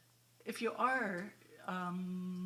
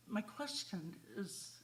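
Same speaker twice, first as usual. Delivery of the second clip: ordinary talk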